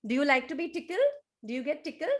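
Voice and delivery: ordinary delivery, nothing marked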